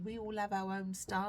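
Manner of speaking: normal talking voice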